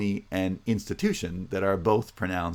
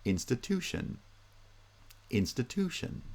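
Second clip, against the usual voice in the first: institution as in-sti-TU-tion, TU higher; primary stress third; 5 semitones higher, 5 dB quieter than usual. Shown as a demonstration. Teacher showing third-syllable primary stress, institution peaking on TU. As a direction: lift TU in institution, keeping it soft. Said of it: "primary stress is the third syllable".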